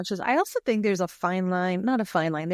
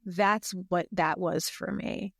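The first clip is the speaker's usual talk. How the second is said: ordinary talk, nothing marked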